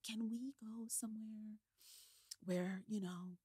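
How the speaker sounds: quiet, secretive ask; hushed. Retold or imitated. Babysitter's hushed private request.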